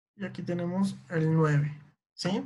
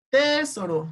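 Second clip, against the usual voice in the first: TEsoro, wrongly stressed on a high TE; stress on first syllable; 6 semitones higher, 3 dB louder, slower than usual. Shown as a demonstration. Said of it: "es esa sílaba que suena más fuerte". (Tesoro con el acento cambiado a TE)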